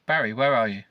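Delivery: acted neighbour calling out. A narrator's reading. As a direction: call across the yard for him. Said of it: "I hear Juliet call".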